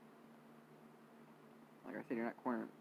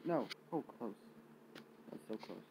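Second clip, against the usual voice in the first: normal talking voice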